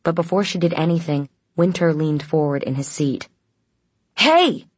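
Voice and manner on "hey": loud, high shout at a guard; raised voice, challenging; angry shout. A narrator's reading. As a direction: call out sharply, pitched way up. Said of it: "he shouted at the guard".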